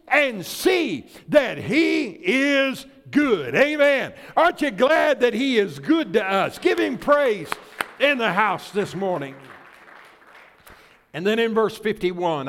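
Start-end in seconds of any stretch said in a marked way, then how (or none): none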